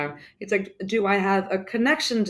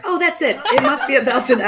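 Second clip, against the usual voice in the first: higher, louder mock realization, breaking into laughter; imitated pleased guess, laughing by the end; imitated eureka; 6 semitones higher, 6 dB louder than usual. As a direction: act out someone who thinks they've solved it, amused. Acted reaction of people fooled by answer.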